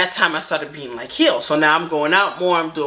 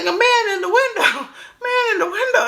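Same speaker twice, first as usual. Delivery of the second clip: high, squealed child impression; much higher mock-frightened little girl voice; imitating scared daughter. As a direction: mimic your screaming daughter, laughter creeping in. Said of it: "I hear my daughter scream".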